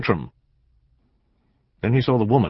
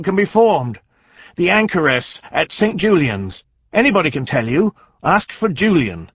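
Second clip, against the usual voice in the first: high, urgent dwarf voice; a little man pressing the lord to hurry; 9 semitones higher, 4 dB louder than usual. A narrator's reading. Dwarf pleads urgently with the knight.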